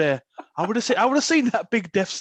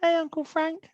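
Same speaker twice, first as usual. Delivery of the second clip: higher, mock innocent kid calling Uncle Frank; mock child voice; 10 semitones higher than usual. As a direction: pipe up like a cheeky little kid. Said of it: "the theme of you staying at Uncle Frank's".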